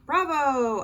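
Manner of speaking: high, loud cheer of bravo; yelling. A narrator's reading. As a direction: yell it out from the audience. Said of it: "I heard Dad yelling through his hands".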